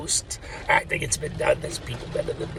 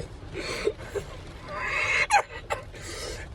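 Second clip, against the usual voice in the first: high, airy laugh near tears; helpless teary laugh; 5 dB quieter than usual. Real, not acted. Vlogger cracking up about God's joke, teary laugh. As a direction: laugh until it almost cries.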